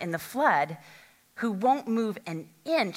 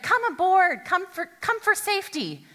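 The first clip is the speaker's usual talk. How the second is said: calling out; 10 semitones higher, 6 dB louder than usual. Acted. Rescuer calls to man on roof.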